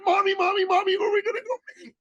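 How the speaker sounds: put-on very high, rushed child voice; excited little boy. Mother retells her little son asking who they'll meet, excited child voice. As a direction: imitate a thrilled kid pestering his mother. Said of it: "in that excited way little boys do".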